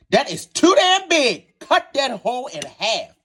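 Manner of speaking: high, slow, hammed-up outrage at the size; mock disgust. Real, not acted. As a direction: stretch it out, scandalized by how big it is. Disgust at a huge oyster.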